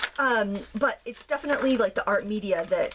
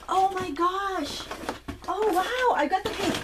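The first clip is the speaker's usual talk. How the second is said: high, wowed exclamation; delighted amazement; 8 semitones higher, slower than usual. Real, not acted. Crafter discovering she got the paint, delighted amazement. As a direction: marvel in a high, thrilled voice.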